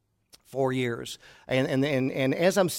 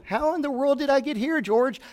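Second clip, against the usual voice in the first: mock bewilderment; 10 semitones higher than usual.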